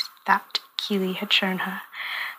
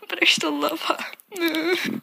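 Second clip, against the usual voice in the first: tearful; 7 semitones higher, slower than usual. Retold or imitated. Heartbroken brother defends girlfriend.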